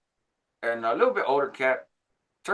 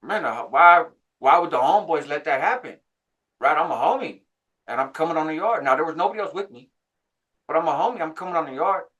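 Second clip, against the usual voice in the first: higher, louder retold complaint; frustrated; 5 semitones higher, 5 dB louder than usual. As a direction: protest to yourself, aggrieved. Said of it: "frustrated, like".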